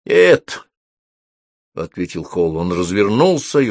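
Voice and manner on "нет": high, loud single word; curt denial. A narrator's reading. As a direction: throw out the answer loudly. Exhausted wizard answers eager question. (громкое высокое отрицание)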